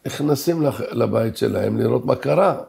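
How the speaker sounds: ordinary talk, nothing marked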